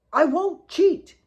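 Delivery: put-on eager boy. A narrator's reading. Alex promising Kate fair play, loud and eager. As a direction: reassure her with a grin.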